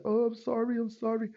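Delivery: high-pitched pleading apology; lightly voiced sorry stranger; imitated apologetic driver. Retold apology of the truck driver.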